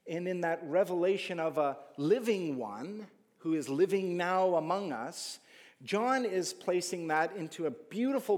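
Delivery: impassioned sermon voice; full-force preaching. Acted. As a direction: drive the point home to the congregation.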